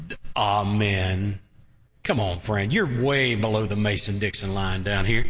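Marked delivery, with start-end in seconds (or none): 0.4-1.4 drawn-out low Yankee "ah-men"; mock a Northerner's stretched AH-men; Yankee accent. Shown as a demonstration. Preacher teasing Northerners in his congregation, Yankee accent. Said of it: "don't say it like a Yankee".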